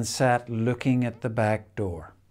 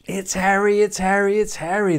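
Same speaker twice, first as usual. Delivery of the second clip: put-on high and louder, thrilled kids; excited children crying; 9 semitones higher, 4 dB louder, faster than usual. A narrator's reading. Children recognise their dog in the bath, high joyful cry. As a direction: cry it out with joy, each time. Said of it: "they cried".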